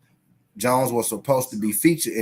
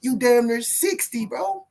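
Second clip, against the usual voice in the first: mock high taunt; 10 semitones higher than usual. Mocking Roy's age, high voice.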